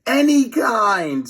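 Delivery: high, loud and slow; tiny friend screaming at his buddy. A narrator's reading. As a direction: shout it in desperation. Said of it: "screamed Fluffy".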